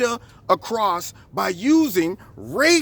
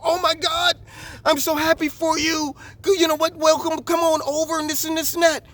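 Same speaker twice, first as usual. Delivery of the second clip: much higher, bubbly mock enthusiasm; gushing welcome; 8 semitones higher than usual. Strangers online gushing a welcome to the woman, excited and high. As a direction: gush like a delighted stranger.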